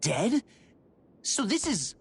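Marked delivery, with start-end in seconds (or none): 0.0-0.4 surprised. Acted. Shocked reply to corpses.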